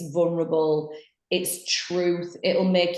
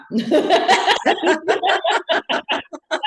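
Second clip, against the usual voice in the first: big real laugh, high and loud; high burst of laughter; 10 semitones higher, 5 dB louder than usual. Real, not acted. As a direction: crack up at your own joke. Cracking up at own impression.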